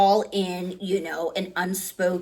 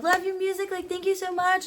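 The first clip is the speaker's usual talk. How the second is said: much higher gushing thanks; gushing fan; 11 semitones higher than usual. Retold or imitated. Fan thanking the singer, high gushing voice. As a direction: gush like a starstruck teen. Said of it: "I'm like".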